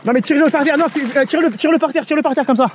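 loud urgent commands; shouting. Real, not acted. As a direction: shout the orders across. Shouting instructions over noise.

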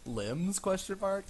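drawn-out um, rising uncertain guess; mock-puzzled, speak the punctuation as a question; self-mocking hesitant query, ending high; imitating own confusion. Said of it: "I was sitting here, I'm like".

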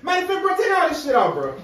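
high, loud furious yelling; angry yelling. Acted. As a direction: scream at him for the money. Friend yelling, demanding clothes off.

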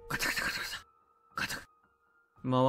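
whispered rattle slowing to one turn; doorknob rattling. Retold or imitated. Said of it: "回していましたよ".

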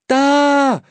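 loud, high shout; boy cries out at the sight. Acted. Boy shouts out as he spots the tunnel. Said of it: "schrie er".

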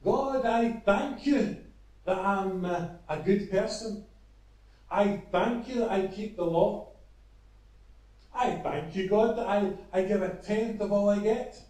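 hammed-up pompous Pharisee; proud loud prayer. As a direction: pray for everyone to hear, full of yourself. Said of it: "he says in a loud voice that everybody could hear".

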